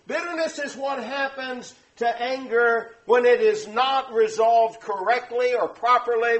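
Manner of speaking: ordinary talk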